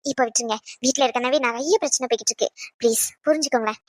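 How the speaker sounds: low, hushed appeal; subdued, placating plea; soft pleading. Acted. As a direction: beg them quietly to let it go. Pleads with them to drop it.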